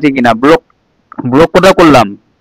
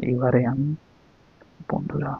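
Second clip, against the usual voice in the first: very soft, lowered voice; hushed aside; 4 semitones lower, 18 dB quieter, slower than usual. Real, not acted. Husband quietly addressing his viewers, hushed. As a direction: keep it secret from someone nearby.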